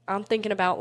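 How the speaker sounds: ordinary delivery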